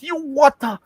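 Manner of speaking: loud, very high startled cry; mock shock. Wife is suddenly attacked, loud mock shock. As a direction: yelp it in a hammed-up high voice.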